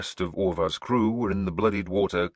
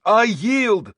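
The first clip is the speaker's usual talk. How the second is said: loud, much higher cry of surrender; 9 dB louder than usual. A narrator's reading. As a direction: shout the surrender desperately. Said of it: "he cried".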